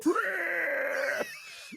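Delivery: high squealing laugh; pig squeal. Retold or imitated.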